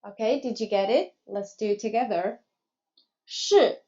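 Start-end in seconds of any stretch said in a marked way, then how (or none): 3.3-3.8 是 starting high, falling sharply; fourth tone. Shown as a demonstration. Teacher models 是 for learners, in fourth tone. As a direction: drop 是 steeply from a high start. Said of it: "and the fourth tone".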